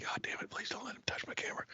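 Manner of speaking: fast, breathless whispered plea; a frantic hushed prayer not to be overheard; panicked whisper. Retold or imitated. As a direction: whisper it in quick panic, barely audible. Cameraman's panicked inner plea.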